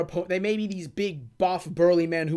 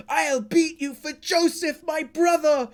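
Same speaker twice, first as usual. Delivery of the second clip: higher, defiant hero vow; acting Caesar; 7 semitones higher, slower than usual. Retelling Caesar's vow to his rival, higher and defiant. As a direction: play the vengeful young fighter. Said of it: "Caesar's about to go".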